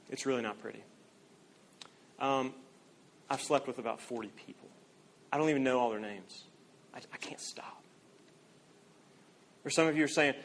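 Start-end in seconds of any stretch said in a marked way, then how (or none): 0.1-7.8 ashamed confession. Acted. Voiced confession of sexual sin.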